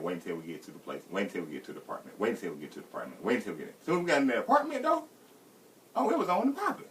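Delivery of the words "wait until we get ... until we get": quieter repeated mutter, low; inner muttered repeat. Retold or imitated. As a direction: keep saying it under your breath. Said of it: "I kept saying".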